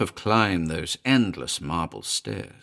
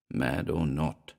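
usual speaking voice

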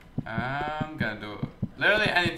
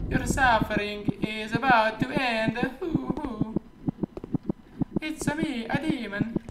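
high put-on Italian demon; mock Italian accent, pitched way up; 10 semitones higher than usual. Gamer giving the game's demon an Italian voice, high and hammy. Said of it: "give him back the Italian voice".

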